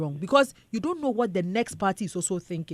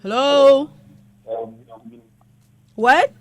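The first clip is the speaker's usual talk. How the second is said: calling out; 11 dB louder, much slower than usual. Real, not acted. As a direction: shout down the phone line. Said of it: "your voice is very low".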